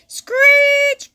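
very high, loud screech; tire screech. A narrator's reading. Reading about race cars, a very high loud tire screech. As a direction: shriek like squealing tires.

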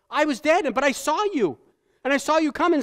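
put-on revived boy's wonder. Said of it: "I'm thinking he said".

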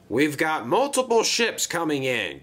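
a hint of a grunting crane; grunted. A narrator's reading. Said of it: "grunted Cranky".

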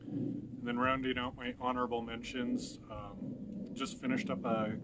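speaking with pipe clenched; talking around a pipe. Real, not acted. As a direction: pipe stem between lips. Seen 1: Pipe stem held between lips in most frames while speaking.

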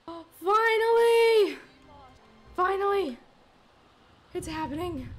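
loud, high, drawn-out cheer; triumphant shout. Real, not acted. Streamer cheering her sim wife finally dying, triumphant shout. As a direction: cheer like the long wait is over.

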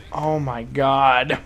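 low, slow, drawn-out oh my god; slow groan. Real, not acted. Gamer after a bad death, slow weary. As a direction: drag it out in defeat.

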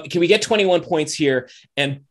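ordinary delivery

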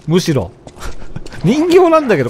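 usual speaking voice

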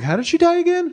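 high, pointed question; suspicious question. Retold or imitated. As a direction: ask it with heavy suspicion. Asking grandpa pointedly.

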